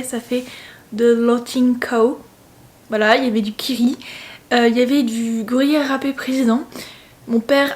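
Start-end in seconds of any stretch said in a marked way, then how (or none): 0.9-2.2 slow, clumsy English accent by a French speaker; self-mocking English accent. Shown as a demonstration. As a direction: sound out "The Lotting Cow" slowly in a heavy French-English accent. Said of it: "avec mon magnifique accent anglais". (accent anglais à la française, lent et maladroit)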